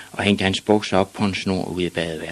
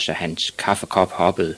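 plain reading voice

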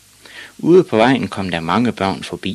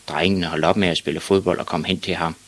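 ordinary delivery, nothing marked

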